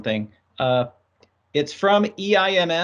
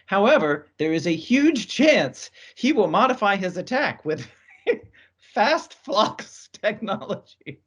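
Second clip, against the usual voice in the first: higher, amused read cracked by a laugh; breaking into laughter; 6 semitones higher than usual. Real, not acted. Host reading a hoax threat email aloud, laughing at the jargon. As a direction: lose it mid-sentence, then push on slowly. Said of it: "Sorry for laughing".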